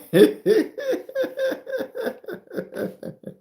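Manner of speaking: high, loud burst of laughter; big laugh. Real, not acted. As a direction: let a real laugh fly up high. Big laugh after rant.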